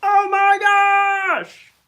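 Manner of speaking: high, drawn-out yell; hammed-up scream of disbelief; mock shocked shout. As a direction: shout it slowly in pretend amazement. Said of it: "point yourself out and be like".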